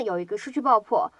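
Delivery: usual speaking voice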